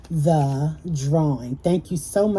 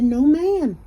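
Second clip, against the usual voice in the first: defensive denial; 9 semitones higher than usual. Retold or imitated. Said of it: "I said".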